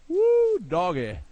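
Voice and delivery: put-on loud, high country-boy holler. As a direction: let out a big hillbilly yee-haw whoop. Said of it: "Starting to turn into a redneck".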